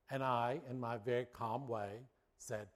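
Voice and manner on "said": normal talking voice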